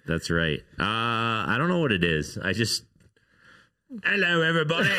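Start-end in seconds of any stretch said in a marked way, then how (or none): 4.0-4.9 high-pitched mock British greeting; hammed-up British accent, pitched up; insulting British accent. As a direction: put on a bad, exaggerated British accent. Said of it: "most insulting British accent".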